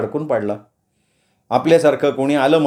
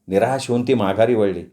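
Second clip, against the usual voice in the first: plain reading voice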